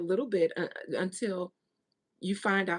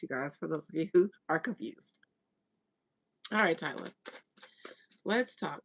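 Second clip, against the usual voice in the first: ordinary delivery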